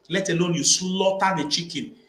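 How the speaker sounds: ordinary talk, nothing marked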